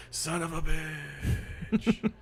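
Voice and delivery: high, very slow drawn-out wail; ghostly voice. Acted. Ghost walked through by a stranger, ghostly groan. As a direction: stretch the curse like a wailing ghost. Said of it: "ghostly voice go".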